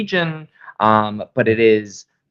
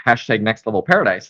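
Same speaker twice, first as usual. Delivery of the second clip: ordinary talk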